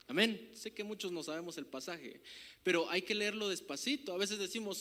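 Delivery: usual speaking voice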